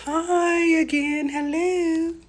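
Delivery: high, slow playful hi; sing-song greeting. Real, not acted. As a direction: coo a teasing hello at the mirror. Greets self in mirror.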